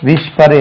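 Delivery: "விஸ்பர்" said as "wishpar", with sh for s; old schoolroom mispronunciation. Retold or imitated. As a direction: say "விஸ்பர்" with a sh and a sounded final r. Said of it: "விஸ்பர் என்று உச்சரிப்போம்". ("விஸ்பர்" என்பதை "விஷ்பர்" என ஷ ஒலியுடன்)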